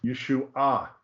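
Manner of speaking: YESHUA as yeh-shoo-AH; third-syllable accent. Shown as a demonstration. Teacher confirming after the listener's comment, YESHUA accented on final AH. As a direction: put the weight on the final AH of YESHUA. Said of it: "makes the accent on the third syllable".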